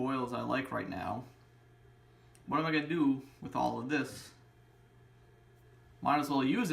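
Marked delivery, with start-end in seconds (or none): none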